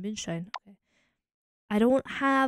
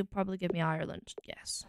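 unvoiced breathy whisper to self; 4 semitones lower, 7 dB quieter than usual. Real, not acted. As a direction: whisper the guess under your breath. Muttering guess to self.

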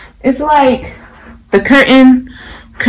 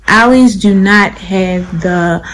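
normal talking voice

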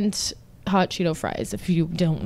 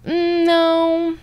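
high, drawn-out reluctant hum; 9 semitones higher, 4 dB louder than usual. Retold or imitated. Woman turning down a man at her door, high reluctant voice. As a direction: squirm out of it in a high voice. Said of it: "I was obviously like".

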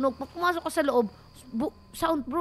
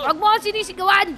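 quick, raised, loud retort; mock indignant protest; 4 semitones higher, 10 dB louder, much faster than usual. Player scolding the boss for shouting, mock indignation. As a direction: snap back in playful offence.

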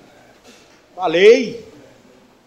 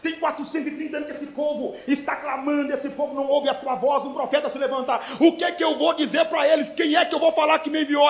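high, raised, pleading questions; Moses protesting to God; 8 semitones higher, 7 dB louder than usual. Acted. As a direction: plead urgently as an overwhelmed prophet questioning God. Moisés questiona Deus, em cena. (Moisés questionando Deus, voz alta e aguda)

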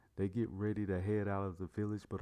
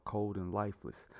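plain reading voice

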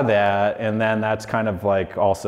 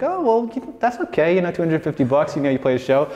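consoling mom; 8 semitones higher than usual. Retold or imitated. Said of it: "And she's like".

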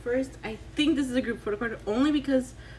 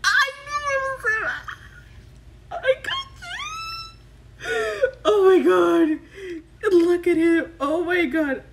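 very high squeal, near happy tears; squeal, then gush loudly over him; ecstatic shriek; 8 semitones higher, 5 dB louder than usual. Real, not acted. Fan seeing the card she guessed, ecstatic shrieking.

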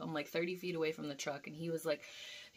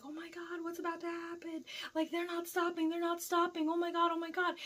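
high, restrained imitation of screaming; mock panicked screaming; 11 semitones higher than usual. Two drivers as truck is about to tip, mock panicked screaming. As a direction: retell the panic in a high, strained squeal without full volume.

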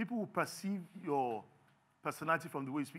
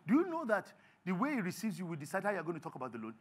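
ordinary talk

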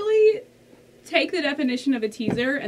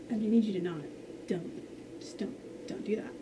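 much softer, fading to a whisper; whispered begging; hushed plea; 6 semitones lower, 12 dB quieter than usual. Real, not acted. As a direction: plead under your breath. Begging idol quietly.

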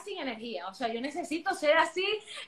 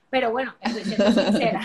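laughing through the words, louder; 3 semitones lower, 7 dB louder than usual. Real, not acted. Admitting to the host that she is being sincere, laughing. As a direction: giggle out an honest admission.

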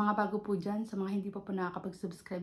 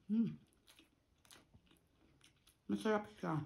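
talking between bites; muffled words around food; mouth full, chewing; 3 semitones higher, 7 dB quieter than usual. Real, not acted. Seen 0: bites rice at 2.4s, chews with full cheeks while speaking.

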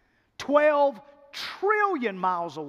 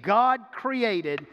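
ordinary talk, nothing marked

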